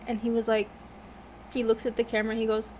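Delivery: ordinary talk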